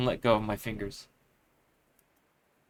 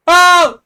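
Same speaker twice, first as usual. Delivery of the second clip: startled shriek; 17 dB louder than usual. Acted. Startled in the dark.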